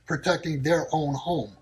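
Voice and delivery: ordinary talk, nothing marked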